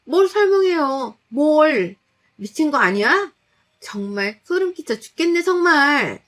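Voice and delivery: high, loud outraged screaming; furious yelling. Acted. Wife yelling at mother-in-law, high furious shouting. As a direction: scream back at her in disgust.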